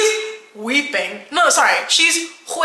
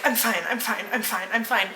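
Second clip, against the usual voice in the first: softer, looping it on autopilot; mock breakdown murmur; 6 dB quieter than usual. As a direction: imitate her repeating it under her breath. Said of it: "breaking down and losing it".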